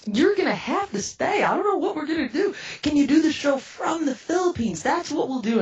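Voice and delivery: high, urgent panic; reenacted frantic insistence; flustered pleading. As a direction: scramble for a way out, pitched high. Frantically seeks a solution.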